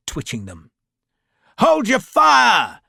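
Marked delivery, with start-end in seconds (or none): 1.6-2.8 loud, high, slow battlefield command; shouted order. A narrator's reading. Officer ordering troops to hold fire, shouting. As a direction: bellow across the field. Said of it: "a voice called".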